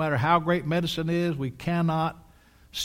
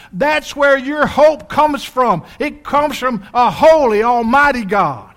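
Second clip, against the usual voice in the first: full-force preaching; 9 semitones higher, 9 dB louder than usual. Real, not acted. Preacher proclaims to congregation where their hope comes from, at full force. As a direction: thunder it out to the congregation. Sermon climax after angels' cries.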